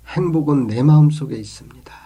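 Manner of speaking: brisk, emphatic declaration; punchy delivery of a key message; firm statement. Real, not acted.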